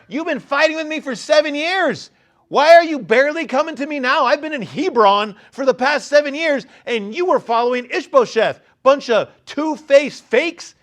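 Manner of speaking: loud, high, accusing tirade; mock accusation. David could have denounced the traitors, loud and angry. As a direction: spit out the insults. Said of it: "he could have said".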